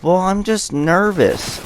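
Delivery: high, slow mimicked girl voice; drawn-out whiny-girl imitation; imitated girl. Imitating the girl admitting nerves.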